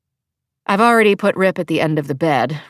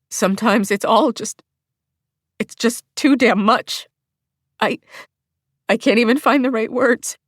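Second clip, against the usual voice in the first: words breaking as she gives up; overwhelmed, faltering; 4 semitones higher than usual. Acted. Held in his arms, faltering and tearful.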